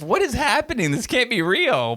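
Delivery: high-pitched, incredulous reenactment; retold disbelief. Viewer retelling reaction to the slashing scene, in high-pitched disbelief.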